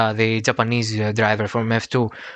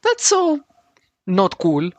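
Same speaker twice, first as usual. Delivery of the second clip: shocked; slower than usual. Real, not acted. Shocked at the news.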